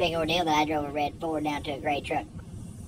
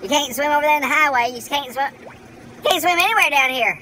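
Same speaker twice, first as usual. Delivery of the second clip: higher, louder whiny reply; voicing Joanna; 9 semitones higher, 5 dB louder than usual. Vlogger voices Joanna answering back, higher and whiny. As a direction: sulk back as the scolded swimmer.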